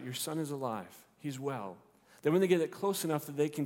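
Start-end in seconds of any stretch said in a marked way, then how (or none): none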